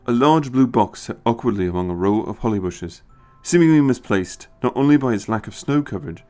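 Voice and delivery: usual speaking voice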